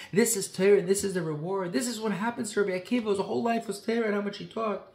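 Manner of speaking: loud, high outcry of protest; loud anguished protest of the angels; angels crying out. Acted. Angels cry out at the martyrdom.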